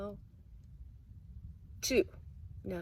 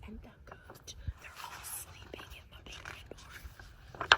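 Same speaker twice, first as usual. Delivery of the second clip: whisper; 16 dB quieter, faster than usual. Acted. Showing kids sleeping animals, soft whisper. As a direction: whisper so the animals stay asleep.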